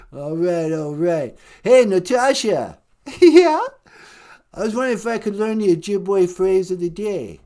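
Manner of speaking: low put-on rocker voice; rocker caller voice. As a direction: drop low and play a laid-back rocker.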